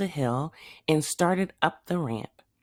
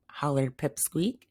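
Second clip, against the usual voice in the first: ordinary delivery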